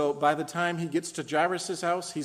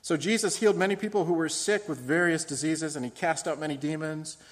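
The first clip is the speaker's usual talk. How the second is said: normal talking voice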